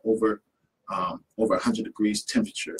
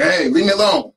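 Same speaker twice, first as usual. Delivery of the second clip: higher, louder irritated pushback; mock-irritated brush-off; annoyed kid; 9 semitones higher, 7 dB louder than usual.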